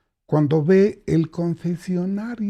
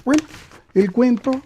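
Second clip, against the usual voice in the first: high playful; 7 semitones higher, 4 dB louder than usual. Shown as a demonstration. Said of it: "es el cuento".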